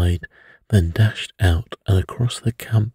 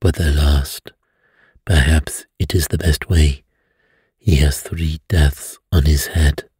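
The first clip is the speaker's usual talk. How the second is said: lower, sombre summing up; grave, lowered detective voice; 3 semitones lower than usual. A narrator's reading. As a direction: close the case with solemn weight. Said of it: "said Poirot gravely".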